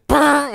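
high buzzing trill; imitated flickering light. Retelling the light switching on, imitated electric buzz. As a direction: buzz like an old fluorescent tube. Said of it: "and it goes".

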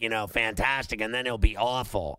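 ordinary talk

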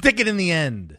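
mock announcer. Co-host butting in with the running joke, mock announcing. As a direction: proclaim it like a silly catchphrase.

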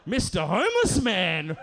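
mock fury at being conned; incredulous outrage. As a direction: draw it out in disbelief. Acted outrage at man's confession.

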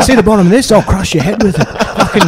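hammed-up menacing threat, a touch of laughter; mock threat. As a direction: play the unhinged MC threatening the heckler. Comedian threatens heckler with mic stand.

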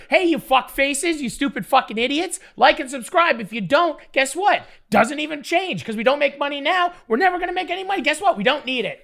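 high-pitched hectoring rant at the viewers; mock abusive plug. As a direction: demonstrate a plug by berating the audience. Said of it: "all you gotta do".

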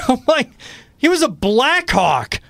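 high, drawn-out disbelief; incredulous protest. Retold or imitated. Retold outrage at the signing.

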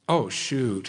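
lightly acted regret on finding a bad score; mock letdown, dropping at the end; disappointed judge. Jesus checking his clipboard at judgment, comic mock dismay. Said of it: "Jesus looking at his clipboard and saying".

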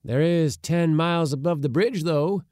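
determined. A narrator's reading. Uncle overruling his doubting nephew, determined. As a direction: insist on it with full force. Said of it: "said my uncle determinately".

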